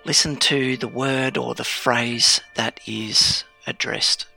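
ordinary delivery

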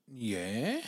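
drawn-out, rising "yeah"; questioning, taken-aback response; surprised. Retold or imitated. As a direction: surprise creeping in on a rise. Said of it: "this really surprised look on her face".

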